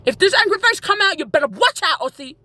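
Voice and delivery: high-pitched playful threat; mock anger. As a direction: warn him with pretend menace. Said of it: "This is my angry face".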